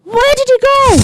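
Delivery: very high, piercing demand; villainess demand. Acted. Bellatrix hunts Potter at the vault, high villainous cry.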